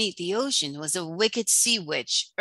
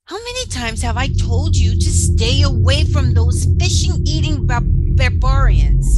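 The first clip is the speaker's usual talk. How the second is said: much louder, higher angry yell at his daughter; furious father; 5 semitones higher, 13 dB louder than usual. Acted. King yelling at his daughter about humans, furious shout. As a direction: roar the warning with full fury.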